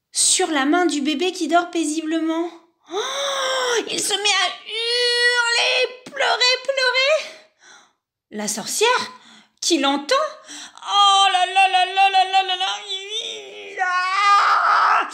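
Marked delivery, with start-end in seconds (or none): 2.9-7.3 high, wailing cry voice; wailing narration. A narrator's reading. Baby burned, narrator wails. 10.1-15.1 high, loud panicky babble; a witch losing her head over a screaming baby; panicked witch. Acted.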